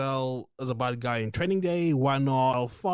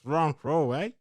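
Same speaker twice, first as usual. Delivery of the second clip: put-on New Zealand accent. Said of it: "your New Zealand accent is different".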